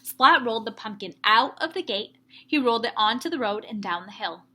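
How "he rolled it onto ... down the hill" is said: plain reading voice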